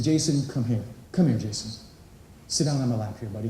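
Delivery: tender dad. Retold or imitated. Father gently calling his crying son onto his lap. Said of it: "looked at it from a different perspective".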